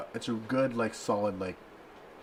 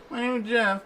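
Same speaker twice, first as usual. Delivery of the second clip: high, louder mock Jeff line; meme quote; 12 semitones higher, 5 dB louder than usual. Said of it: "in recent memory".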